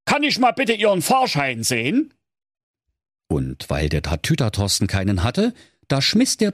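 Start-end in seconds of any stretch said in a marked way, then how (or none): 0.1-2.1 bus driver voice. A narrator's reading. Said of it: "sprach dankbar".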